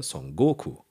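Son Goku with a loaded GO; Germanised Son GOku, stress before the last syllable; German-style stress. Shown as a demonstration. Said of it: "immer die vorletzte Silbe".

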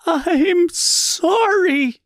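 slow, very high anguished cry; high tearful scream of sorry; screamed apology. A narrator's reading. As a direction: wail it out in despair. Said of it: "she screamed".

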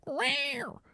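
very high imitated meow; high-pitched meow; dog meowing. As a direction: mimic a cat's cry from a supposed dog. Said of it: "And he's like".

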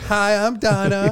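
lightly voiced woman, laughing; Donna voice. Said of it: "I'm gonna start practicing now".

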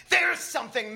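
yelling excitedly at the window; feverish yell. A narrator's reading. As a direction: shout it out with glee. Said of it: "Matt feverishly yells".

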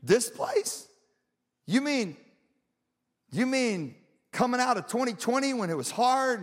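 high, halting disbelief; incredulous questioning. Acted. Preacher voices doubter of hard places, incredulous. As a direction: question it as if you can't believe it. Acts out doubting reaction to verse.

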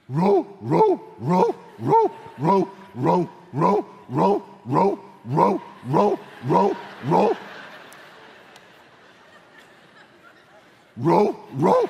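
yapping bark imitation, high and loud; dog barking. Preacher imitating dogs barking all night, loud yapping.

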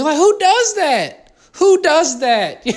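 slow, high, laughing disbelief; incredulous. Real, not acted. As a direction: drag out the outrage with a laugh. Mock outrage at a friend.

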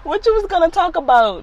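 very high, loud incredulous question; laughing disbelief. Retold or imitated. Mom teasing son about his video topic, laughing disbelief. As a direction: squeal the question through amusement.

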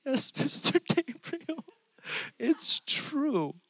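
high, slow, imitated blubbering confession; replaying a kid's tearful confession, half-laughing; mock bawling. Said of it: "I start bawling and say".

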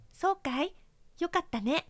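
put-on a friendly old trap, pleased; kindly mousetrap. Mousetrap invites mouse back.

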